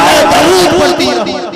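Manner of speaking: very high, shouted, slowing mother's voice; mother's devout cry. Acted. Mother praying over her nursing son, shouted devotion. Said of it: "माँ कहती है".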